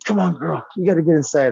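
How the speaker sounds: real urgent call to a pet; calling the dog. Real, not acted. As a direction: call your puppy in, loud and worried. Calling the puppy inside urgently.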